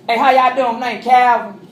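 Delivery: hammed-up Mississippi accent greeting; Southern accent. Speaker introduces himself from Mississippi, Southern accent. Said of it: "I talked like this".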